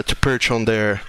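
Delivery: ordinary talk, nothing marked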